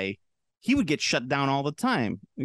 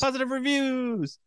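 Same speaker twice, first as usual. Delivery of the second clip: mock high-pitched echo; 12 semitones higher than usual. Echoes in high voice.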